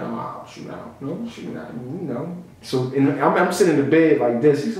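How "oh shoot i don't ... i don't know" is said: whispered nervous denial. Retold or imitated. Whispering a nervous denial to the suspicious girlfriend. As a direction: whisper it, playing innocent. Playing dumb in bed.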